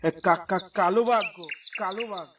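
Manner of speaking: soft, low stammer fading away; panicked stammer. Acted. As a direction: stammer it while fleeing into the distance. Fleeing villager stammers, running away.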